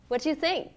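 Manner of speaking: "What do you" reduced to "whatchu"; swallowed letters. Shown as a demonstration. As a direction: squeeze it into "whatchu think". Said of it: "tôi nuốt chữ cái trong câu sau đây".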